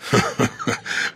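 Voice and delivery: soft breathy laugh; hearty laugh. A narrator's reading. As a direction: laugh playfully, quietly. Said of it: "задорно рассмеялся".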